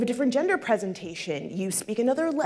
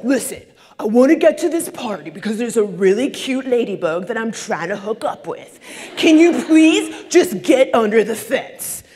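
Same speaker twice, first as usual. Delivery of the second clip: annoyed caterpillar; 7 dB louder than usual. Acted. Caterpillar voiced in story.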